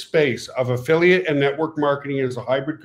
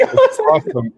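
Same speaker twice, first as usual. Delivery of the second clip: squealing delight; 4 dB louder than usual. Real, not acted. Laughing over losing his job.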